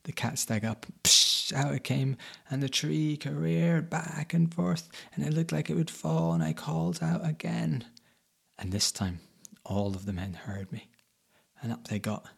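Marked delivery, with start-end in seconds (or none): none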